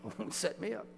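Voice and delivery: preacher recalling a tricky interview, wry; wry aside. Retold or imitated. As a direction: roll your eyes at the setup. Wry thought before interview.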